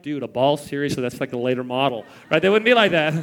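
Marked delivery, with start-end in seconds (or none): 0.0-2.0 put-on low, unimpressed bored kid. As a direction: sound underwhelmed by the gift, lower.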